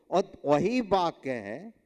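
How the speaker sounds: ordinary talk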